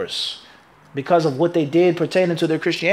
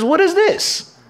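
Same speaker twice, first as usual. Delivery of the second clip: high, amazed cry of someone who has never seen a trench; startled high "what is this"; astonished question; 12 semitones higher, slower than usual. Retold or imitated. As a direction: gasp it out, baffled by the new idea. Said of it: "It says".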